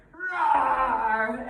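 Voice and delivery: loud, growled playful roar; loud lion roar. Shown as a demonstration. Teacher roars loudly while walking the mat like a lion. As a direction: roar out big as you stride. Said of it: "let's go to the top of the mat".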